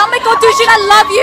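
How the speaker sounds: high, gushing fangirl quote; excited fan greeting. Retold or imitated. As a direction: replay the excited declaration to an idol. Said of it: "I was like".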